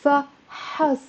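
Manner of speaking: فحص as two syllables, fa-HAS; فحص broken into fa, has; fatha added. Shown as a demonstration. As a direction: open a clear "a" after the ح in فحص. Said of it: "if I put the فتحة".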